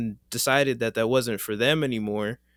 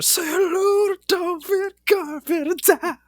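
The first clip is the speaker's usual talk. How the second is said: high sing-song ad jingle; 4 dB louder than usual. Retold or imitated. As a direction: sing it like the old ad. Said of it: "I like the original one better".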